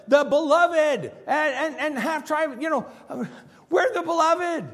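high, loud, swelling boast; proud self-assurance. Acted. Preacher acting the northern kingdom's smug claim, high and loud. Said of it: "anybody in the northern kingdom who says".

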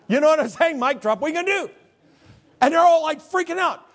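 high, loud preaching voice; fiery sermon delivery; full-force preaching. Acted. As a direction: preach it out over the congregation. Preacher building excitement.

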